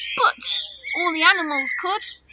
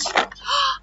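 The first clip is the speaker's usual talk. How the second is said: sharp high little gasp of surprise; mock gasp; 10 semitones higher than usual. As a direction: gasp as the bits fly out. Toy shaker items fly out.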